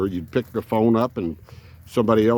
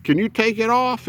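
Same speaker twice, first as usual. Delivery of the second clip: put-on high, insistent hillbilly old-timer; 13 semitones higher than usual. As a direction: refuse it like a stubborn old hillbilly. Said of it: "old man hillbilly voice".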